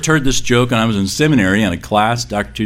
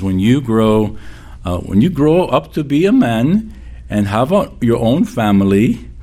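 lightly voiced great-grandmother with a hint of her Russian accent; slightly higher, old woman's accent; 3 semitones higher than usual. Preacher quotes his great-grandmother's prayer with a light accent. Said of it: "she says".

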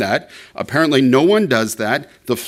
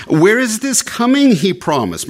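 higher-pitched mock challenge, lightly voiced; mildly scoffing question, pitched up; 8 semitones higher than usual. Voicing scoffers who doubt the promised return, mildly scoffing. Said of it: "in their scoffingness".

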